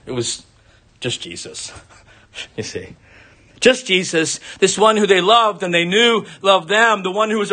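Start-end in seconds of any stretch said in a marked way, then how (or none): 0.1-3.0 whispered, much softer, with a small laugh; hushed. Real, not acted. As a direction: breathe "just Jesus" in quiet wonder. Marvelling at the verse.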